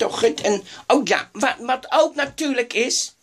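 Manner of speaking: ordinary talk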